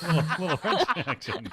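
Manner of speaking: giggling, high and loud; laughing. Real, not acted. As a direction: laugh through the words. Joking, laughing.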